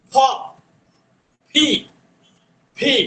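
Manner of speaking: ফি drilled with both lips together and a puff of air; aspirated p. Shown as a demonstration. Teacher drilling the P sound for students. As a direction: repeat ফি with a breathy lip burst. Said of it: "two lips together".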